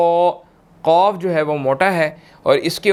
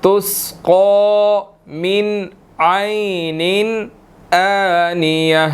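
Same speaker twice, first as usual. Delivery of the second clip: slow, drawn-out tajweed recitation; slow Quranic recitation; 2 semitones higher, much slower than usual. Shown as a demonstration. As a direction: recite slowly with long vowels. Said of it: "teacher recites the verse".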